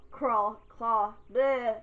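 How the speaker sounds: stumbling over the word claw; fumbled word, then a flustered bleh; tongue-tied slip. Real, not acted. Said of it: "that should be cut out".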